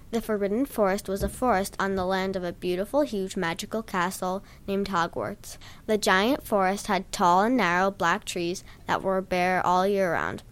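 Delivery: ordinary delivery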